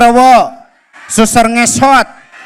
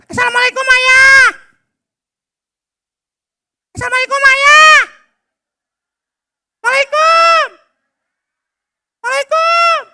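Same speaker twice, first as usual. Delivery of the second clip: put-on child Abdi shouting greeting; 14 semitones higher than usual. Boy Abdi calling salam to his father, high shout. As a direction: call out the greeting to dad.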